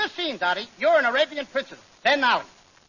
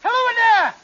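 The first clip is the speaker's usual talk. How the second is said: calling into tent; 7 semitones higher than usual. Acted. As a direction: shout into the tent to the princess.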